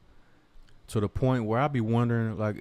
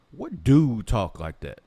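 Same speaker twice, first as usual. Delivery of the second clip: louder, slow, drawn-out disbelief; outraged question; 4 semitones higher, 6 dB louder than usual. Real, not acted. Ranting vlogger demands what man talks like Talib, loud and slow. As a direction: lean hard on every word, appalled.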